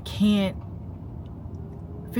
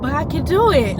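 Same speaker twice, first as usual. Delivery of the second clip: mock protest; 7 semitones higher than usual. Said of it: "And I was like".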